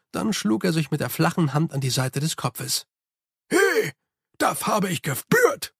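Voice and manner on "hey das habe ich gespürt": high, delighted servant voice; surprised Igor. Acted. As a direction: Igor catching a jolt. Igor feels the pressure drop. (hohe, überraschte Igor-Stimme)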